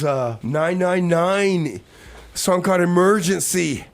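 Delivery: ordinary talk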